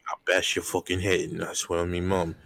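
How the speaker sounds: mock British tough-guy threat; fake British accent. British Batman threatening the Riddler, fake British accent. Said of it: "fake accent that you're doing".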